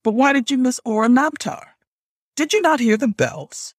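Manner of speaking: indignant questioning; stern interrogation. Acted. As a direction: press the culprit for an explanation. Grandmother interrogating, pressing for answer.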